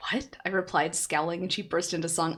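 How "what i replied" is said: usual speaking voice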